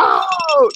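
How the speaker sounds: long, loud high "oh!"; drawn-out hype holler; crowd's amazed cry. Retold or imitated. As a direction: imitate a whole crowd erupting at a big move. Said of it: "everybody was like".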